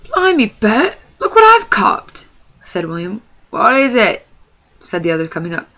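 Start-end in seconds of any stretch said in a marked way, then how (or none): 0.0-2.2 high, loud, slow troll crowing; gleeful troll. Acted. Troll William finds a hobbit. 3.5-4.3 curious troll. Acted. Trolls ask about the catch.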